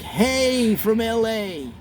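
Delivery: lightly done Gregory Peck hello; mild Hollywood-star greeting; Gregory Peck impression. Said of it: "Be like Gregory Peck".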